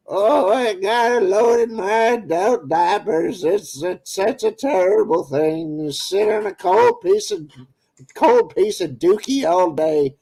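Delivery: put-on little old lady. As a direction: play a frail granny who can't hear. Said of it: "I told him one time".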